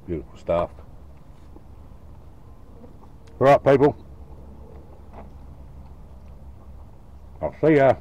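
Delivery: talking with a mouth full of food; words muffled by a mouthful. Real, not acted. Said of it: "Talking again with me mouth full".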